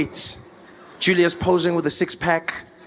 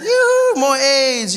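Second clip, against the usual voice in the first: excited; 7 semitones higher, much slower than usual. Pretend. Mock craving call.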